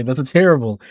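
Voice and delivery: ordinary talk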